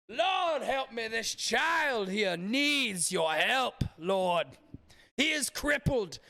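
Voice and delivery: a mega-church faith healer milking the congregation; televangelist impression. Streamer mocking a televangelist to his chat, as a hammed-up high preacher. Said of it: "do my voice impressions like that".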